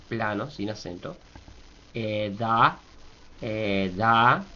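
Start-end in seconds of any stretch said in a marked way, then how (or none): 1.9-4.4 "Eda" starting low and rising on "da". Shown as a demonstration. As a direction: begin the E of "Eda" low, then lift the "da". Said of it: "acento bajo al principio".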